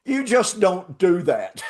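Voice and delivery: high, slow, laughing amazement; excited, laughing. Real, not acted. Excitedly retelling the catch.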